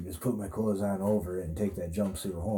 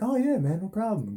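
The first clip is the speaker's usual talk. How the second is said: much higher, breezy easy-going yes; cheery stranger; 10 semitones higher than usual. Retold or imitated. Burger King stranger happily lending change, high and breezy. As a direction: toss it off like it's nothing.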